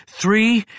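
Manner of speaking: ordinary delivery, nothing marked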